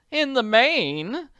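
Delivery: grudging worried woman; reluctant anxious lady. Acted. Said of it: "admitted reluctantly".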